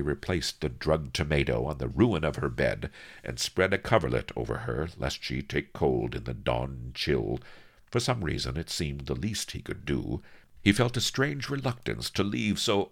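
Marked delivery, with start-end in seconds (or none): none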